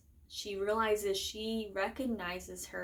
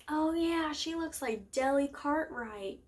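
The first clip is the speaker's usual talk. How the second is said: higher, louder quick-cover act; raised voice, playing along to cover for someone; Peeta; 6 semitones higher, 4 dB louder than usual. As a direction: imitate someone chiming in, pitched up. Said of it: "And then Peeta's like".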